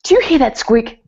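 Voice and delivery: mock anxious husband fussing over the car; tense, fretting husband. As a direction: imitate a tense husband straining to catch a noise. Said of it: "he'd be sitting there so tense".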